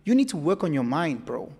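normal talking voice

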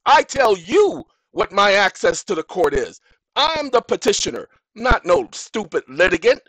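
loud, high, furious outburst; real anger. Real, not acted. Insisting on his rights to the court, real anger. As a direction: lay down the law. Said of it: "that's letting you know that's real".

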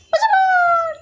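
very high, loud drawn-out call; shouted command. Real, not acted. Repeated command to a disobedient dog. (খুব উঁচু গলায় চেঁচিয়ে হুকুম)

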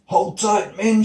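high, loud rallying shout; leader's urgent call; shouted order. Acted. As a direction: shout to your men in the struggle. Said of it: "shouted Maui".

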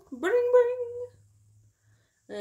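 high ringing bring bring like a phone; phone ring imitation.

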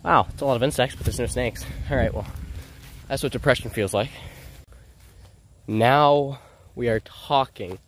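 usual speaking voice

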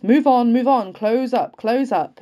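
lieutenant shouting commands; loud, high, urgent officer's call; shouted orders. A narrator's reading. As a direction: shout to drive the troops forward. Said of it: "He sees us and shouts".

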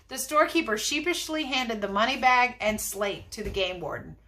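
ordinary delivery, nothing marked

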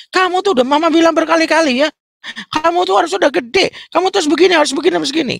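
shrill-pitched mock nagging mother; nagging mom. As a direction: rant at your teenager like a fed-up mom.

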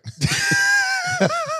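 a laugh that sounds almost like crying; a pained high laugh at his own bad luck; high wailing laugh. Real, not acted. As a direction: let the laugh climb high and break. Laughs hard at own misfortune.

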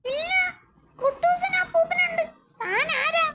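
high, fast, squeaky cartoon sidekick. Cartoon imp questioning the visitor, high and fast. Cartoon character answers the door.

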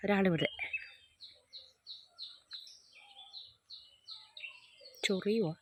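soft, hushed remarks; quietly telling a friend the goat is looking. Real, not acted. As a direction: keep your voice down, it's watching. Quietly watching goats nearby.

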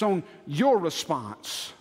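"your" punched out in "your response"; stress on YOUR. Shown as a demonstration. Preacher turns it to the congregation, stressing "your". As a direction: lean hard on "your". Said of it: "He puts the emphasis on".

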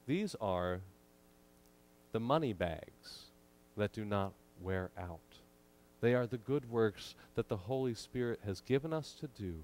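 usual speaking voice

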